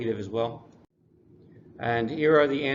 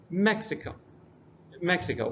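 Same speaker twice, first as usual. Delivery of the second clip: Mexico with a higher first syllable, then falling; stress on first syllable; 5 semitones higher than usual. Shown as a demonstration. Teacher modeling three-syllable words, Mexico stressed on the first syllable. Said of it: "the emphasis is there at the first syllable".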